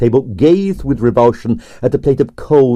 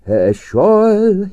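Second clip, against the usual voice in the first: lightly voiced absent-minded old husband, higher; mild mumble; 9 semitones higher than usual. A narrator's reading. Old husband mumbling as he ambles off. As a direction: murmur it to himself, a little higher. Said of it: "he mumbled".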